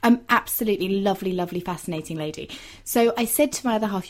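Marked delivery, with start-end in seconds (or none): none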